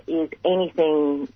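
ordinary talk, nothing marked

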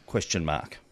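ordinary delivery

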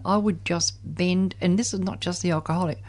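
ordinary talk, nothing marked

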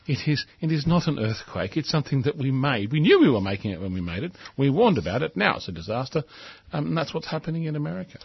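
normal talking voice

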